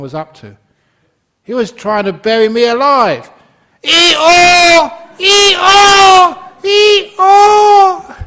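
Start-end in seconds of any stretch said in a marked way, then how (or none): 1.4-3.3 loud, high panicked cry; panicked shout. Acted. Donkey in the well panics at the farmer, loud high cry. 3.8-8.2 very loud, very high bray, falling each time; desperate donkey bray. Pretend. Donkey being buried braying at the farmer, top-of-voice bray. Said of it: "At the top of his voice".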